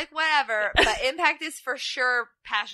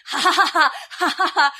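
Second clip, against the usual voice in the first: loud, higher mock-maniac laugh; maniacal laugh; 6 semitones higher, 6 dB louder than usual. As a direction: cackle it like a crazed fighter. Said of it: "his eyes are big and he's like".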